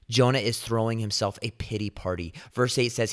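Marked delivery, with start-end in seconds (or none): none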